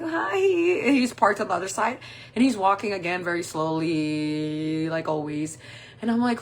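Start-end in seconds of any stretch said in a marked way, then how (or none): 0.0-0.9 high-pitched, drawn-out little hi; acted high hello; fake-sweet greeting. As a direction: put on a sweet, sing-song greeting. Acts greeting her slow date.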